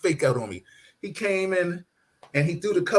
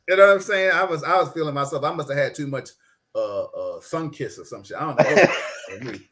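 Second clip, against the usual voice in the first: ordinary talk, nothing marked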